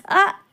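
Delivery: a short high yelp of pain from hitting the head; sudden high ow; sharp pain cry. Acted. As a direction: yelp as the head hits something hard. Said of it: "மண்டையில இடிச்சுக்கிட்டேன்".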